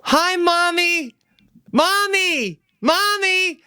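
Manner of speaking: put-on high, slow childish call of Mommy; kid yelling for mommy. Imitating the seven-year-old calling to his mother across the field. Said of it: "he yells just like this".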